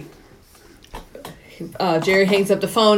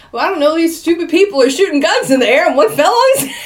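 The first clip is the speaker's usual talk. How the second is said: rising, louder, faster flustered excuse; 9 semitones higher, 5 dB louder, faster than usual. Retold or imitated. Henchman explaining to his boss how the kid died, flustered. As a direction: gabble it out nervously, cracking up.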